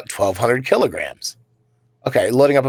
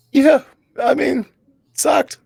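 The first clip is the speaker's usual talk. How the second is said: much higher squeaky voice on "sucked"; squeaky rueful; 13 semitones higher, slower than usual. Real, not acted. Recalling the slashed palm to his cohost, squeaky and rueful. Rueful comment on the cut hand.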